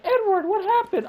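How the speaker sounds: mock-nonchalant falsetto impression; very high, airheaded girlish mimicry; Rebecca. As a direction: mimic a woman far too unbothered, in falsetto. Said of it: "Rebecca reacted very nonchalantly".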